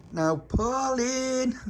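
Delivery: high, long drawn-out call; shouted through letterbox. A narrator's reading. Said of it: "shouted through the letterbox".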